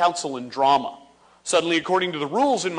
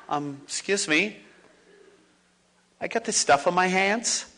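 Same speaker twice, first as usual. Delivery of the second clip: put-on sneaky kid; 4 semitones lower than usual. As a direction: play the kid sneaking a taste of paste. Acting kid eating paste.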